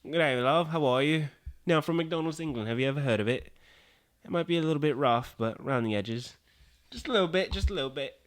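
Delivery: rough comic English accent. Shown as a demonstration. Guest showing off to podcast host, put-on English accent. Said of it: "English accent where it goes".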